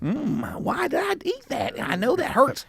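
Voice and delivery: high, soft witchy muttering; a hint of an old crone scolding herself; old witch voice. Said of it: "You talk to yourself like an old witch".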